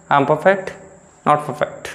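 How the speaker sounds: ordinary delivery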